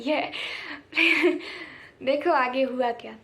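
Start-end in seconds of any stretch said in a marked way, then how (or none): 0.4-1.7 a short real laugh; bright giggle to open; amused laugh. Real, not acted. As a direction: laugh before the story begins. Laughing before telling story.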